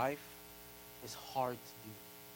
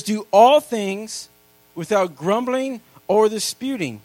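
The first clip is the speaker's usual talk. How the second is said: loud, slow, hammered scripture line; proclaiming scripture; 5 semitones higher, 12 dB louder, slower than usual. Acted. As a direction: proclaim it word by word to the church.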